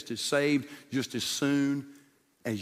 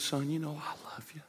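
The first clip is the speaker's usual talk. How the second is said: soft, gentle whisper; tender whisper; 11 dB quieter than usual. Acted. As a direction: reassure him in a hush. Jesus answers tenderly.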